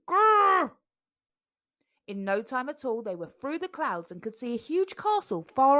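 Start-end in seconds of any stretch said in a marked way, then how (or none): 0.1-0.8 growl. A narrator's reading. Growl effect.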